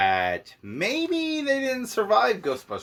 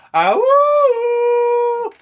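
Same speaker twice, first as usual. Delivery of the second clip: high, loud hooting cry; bouncy playful with animal noises; 10 dB louder than usual. Retold or imitated. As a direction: let out a high whooping woo. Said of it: "And he goes".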